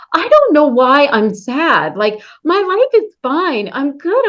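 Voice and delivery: a hint of a baffled woman; imitating puzzled women. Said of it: "I've heard so many women say".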